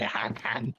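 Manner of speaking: goose-like honk, nasal mock call. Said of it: "That's my alligator noise".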